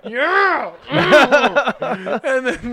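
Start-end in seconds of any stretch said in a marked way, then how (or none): 0.0-0.7 mock cheer.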